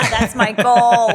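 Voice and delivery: a big laugh through the words. Real, not acted. Laughing at being asked to fix the news.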